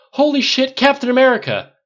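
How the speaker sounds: loud, high starstruck exclamation; blurted out. A narrator's reading. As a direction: burst out in astonished excitement. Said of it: "then blurted".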